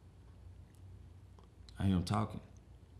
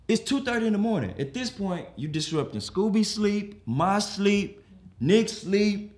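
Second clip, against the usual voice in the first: high, raised-voice complaint; exasperated scolding; 7 semitones higher, 4 dB louder, slower than usual. Acted. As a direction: tell off the noisy guest, listing each grievance. Scolding houseguest at 2:30 a.m.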